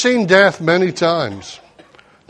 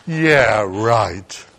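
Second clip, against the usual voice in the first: sarcastic; 10 semitones lower, 3 dB quieter than usual. Retold or imitated. Sarcastic family reply.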